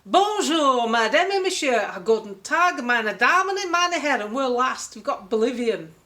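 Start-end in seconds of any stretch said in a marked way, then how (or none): 0.0-4.2 loud, higher multilingual welcome announcement; mock ship announcer. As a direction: ham up the cruise tannoy greeting in every language. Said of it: "when you walk in that ship, is".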